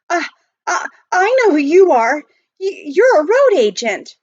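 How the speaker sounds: high, loud, stammering fright; a terrified boy facing the robber; stammering fear. Acted. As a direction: blurt it out, scared stiff.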